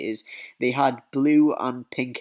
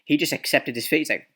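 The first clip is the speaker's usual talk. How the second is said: ordinary delivery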